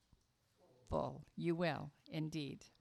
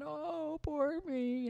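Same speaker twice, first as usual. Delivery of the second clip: higher, mock-sobbing little boy; whiny crying boy; 8 semitones higher, much slower than usual. A narrator's reading. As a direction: wail it like a spoiled child, pitched up. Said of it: "the boy was just crying and carrying on".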